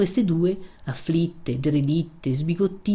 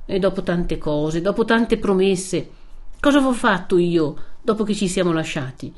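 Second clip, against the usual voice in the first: rising, louder impassioned outburst; Renzo, angry protest; 4 semitones higher, 4 dB louder than usual. Acted. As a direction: refuse her, hurt and furious. (Renzo arrabbiato e ferito)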